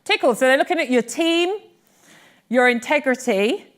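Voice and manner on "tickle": Tickle called out high and loud; triumphant recall. Shown as a demonstration. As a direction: pop Tickle out like a quiz answer. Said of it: "Can I even remember it myself?".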